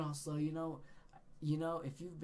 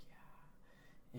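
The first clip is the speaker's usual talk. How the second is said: dazed whisper; 15 dB quieter than usual. Retold or imitated. Answering worried friends while very high, dazed whisper. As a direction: imitate a stoned, barely audible whisper.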